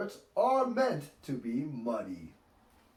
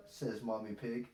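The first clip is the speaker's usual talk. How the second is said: plain reading voice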